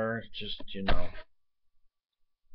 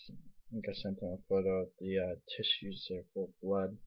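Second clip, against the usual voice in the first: ordinary talk, nothing marked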